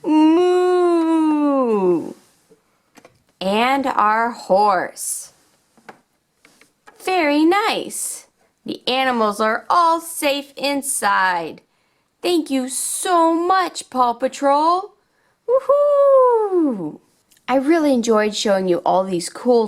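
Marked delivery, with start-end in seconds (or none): 0.0-1.2 loud drawn-out moo; cow moo. Pretend. Found cow greeting the kids, a loud moo. 3.4-14.9 put-on upbeat, sing-song storytelling for little kids; toy play narration. Showing toys to young viewers, upbeat and sing-song. 15.5-17.0 high, loud woohoo; cartoon cheer. Paw Patrol celebrating the safe animals, a high loud cheer.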